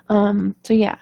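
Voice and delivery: usual speaking voice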